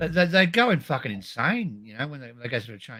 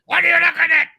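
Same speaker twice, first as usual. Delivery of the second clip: angry; 10 semitones higher, 7 dB louder than usual. Retold or imitated. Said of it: "She was fucking".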